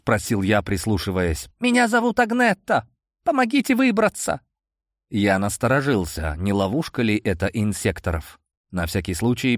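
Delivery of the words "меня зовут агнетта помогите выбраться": high, desperate plea; pleading girl voice. A narrator's reading. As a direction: beg to be let out. Trapped girl pleads from cell.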